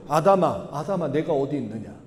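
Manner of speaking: low, softened call; lightly voiced God calling out gently for someone; God calling Adam. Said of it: "부르셨어요".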